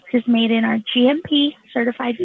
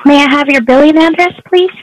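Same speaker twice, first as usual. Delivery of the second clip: raised voice to be heard; loud; 3 semitones higher, 11 dB louder than usual. Acted. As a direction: push the question out loudly. Agent repeats request louder.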